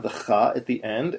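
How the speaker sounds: normal talking voice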